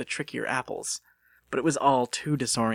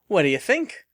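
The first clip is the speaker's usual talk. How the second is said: high, louder proud question; showing off; 7 semitones higher, 6 dB louder than usual. Acted. Missus Bittersworth showing off festival overalls to Lucas. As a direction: strike a pose and ask for praise. Landlady showing off her costume.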